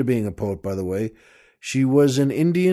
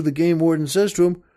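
normal talking voice

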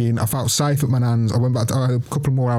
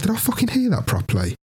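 disbelieving; 10 semitones higher than usual. Retold or imitated. Stunned inner thought.

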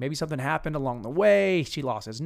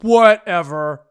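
loud, drawn-out dismissive mimicry; changed voice; 2 semitones higher, 10 dB louder, slower than usual. Guy dismisses the girl's complaint, loud mock scoff. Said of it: "See how I changed his voice already?".

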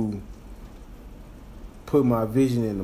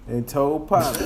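normal talking voice

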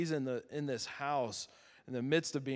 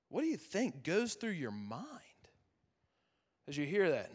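half-whispered, higher-pitched question; hushed question; 3 semitones higher than usual. Real, not acted. Preacher asks what goes through your mind, hushed. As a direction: drop into a whisper to ask it. Preacher invites listeners into Peter's thoughts.

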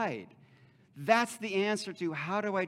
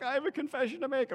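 high, quick imitation of a weeper; mock tearful confession; 10 semitones higher, faster than usual. Student confessing on stage before everybody, mock tearful. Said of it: "starts weeping in front of everybody".

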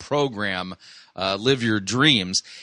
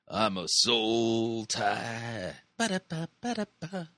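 spooky ghost voice; 8 dB quieter, slower than usual. Pretend. Spooky voice of a soul tie.

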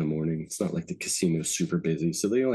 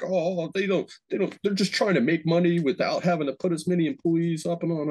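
mocking the other guy; 9 semitones higher than usual. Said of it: "He's like".

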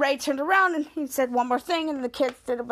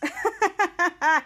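higher-pitched staccato mock laugh; put-on ha-ha laugh; imitated laugh; 4 semitones higher than usual. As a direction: act out a character laughing. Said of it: "And Miles laughs".

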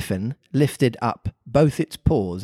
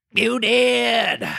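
Mock Turtle voice; 10 semitones higher than usual. A narrator's reading.

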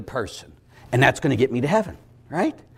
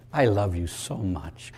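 slow, softer tender tone; gentle voice of God; 5 semitones lower, 6 dB quieter, slower than usual. Retold or imitated. God addressing a soul after death, soft and tender. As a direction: speak it tenderly and slowly.

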